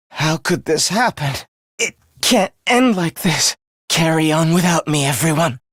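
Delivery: low, weakened voice of the fallen; defeated. Acted. Fallen fighter to comrades carrying on, low and defeated. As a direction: sink low as if beaten.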